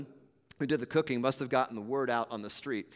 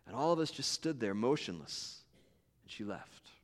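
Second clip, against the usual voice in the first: ordinary delivery, nothing marked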